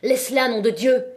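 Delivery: put-on man leaping to defend the girl, loud and furious; frantic outburst. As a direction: shout at him to let her go. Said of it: "Il revint d'un bond affolé".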